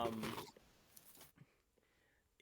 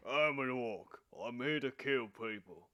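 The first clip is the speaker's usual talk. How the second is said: slow orc voice with a touch of Cockney; slower than usual. Shown as a demonstration. Showing friends how an orc talks, slight Cockney accent. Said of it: "little bit of a Cockney accent".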